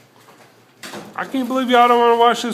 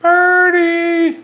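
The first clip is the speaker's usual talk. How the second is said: high, loud bird call; 8 semitones higher, 4 dB louder than usual. Real, not acted. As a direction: shout out to the bird. Calling out to a bird.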